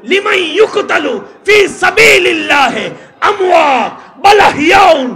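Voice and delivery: high, shouted sermon with drawn-out words; full-force preaching. Real, not acted. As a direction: thunder it out to the crowd, loud and high. (গলা ফাটিয়ে ওয়াজ)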